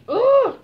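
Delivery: high, loud frustrated ugh; frustrated groan. Real, not acted. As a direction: groan at the ruined slime. Slime failure frustration.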